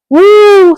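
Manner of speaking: excited whoop. Real, not acted. Excited about [name].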